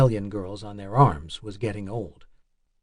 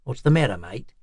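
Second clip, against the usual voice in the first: put-on light Australian accent, casual; a friendly Aussie officer asking, Australian accent; lightly voiced Australian officer, Australian accent. A narrator's reading. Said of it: "speaking in an Australian accent".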